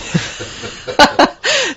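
real giggling fit on air; high helpless laugh. Real, not acted. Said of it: "So you've made me laugh now".

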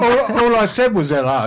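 high laughing protest. Real, not acted.

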